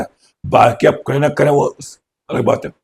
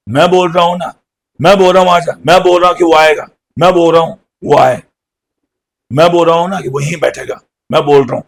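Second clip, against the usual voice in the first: louder, higher, fast insisting; 4 semitones higher, 4 dB louder, faster than usual. Retold or imitated. Speaker demonstrating insisting on someone, pushy and loud. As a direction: demand it again and again. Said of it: "insist का मतलब होता है जिद करना".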